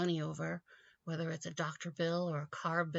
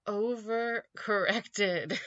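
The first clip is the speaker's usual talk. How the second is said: slow, louder punchline; one-word verdict; 3 semitones higher, 5 dB louder, much slower than usual. Real, not acted. Delivering his one-word verdict on Romney, slow and emphatic. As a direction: drag out "Overcorrected." slowly, louder. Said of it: "One word".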